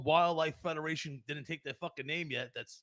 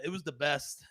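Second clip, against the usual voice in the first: ordinary talk, nothing marked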